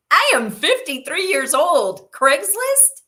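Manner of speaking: high, loud, disbelieving squeal; incredulous disbelief. Retold or imitated. Telling how she balked at Craigslist, incredulous squeal. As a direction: scoff at the idea in shock. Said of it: "And I was like".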